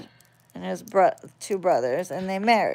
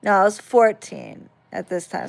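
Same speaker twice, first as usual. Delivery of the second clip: ordinary talk, nothing marked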